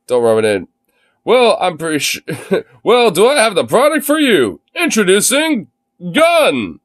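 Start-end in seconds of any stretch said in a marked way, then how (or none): 1.2-6.8 excited ad announcer pitch; infomercial voice. Acted. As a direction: hype up the product reveal. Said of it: "Read in infomercial voice".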